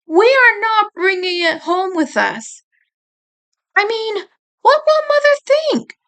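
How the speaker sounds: protesting, fretting over Mother; worried protest. A narrator's reading. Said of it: "I refused".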